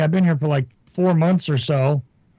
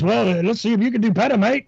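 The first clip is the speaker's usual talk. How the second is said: higher-pitched retort, lightly acted; lightly imitated radio host; 6 semitones higher than usual. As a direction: quote a challenge in a slightly put-on voice. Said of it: "And he goes".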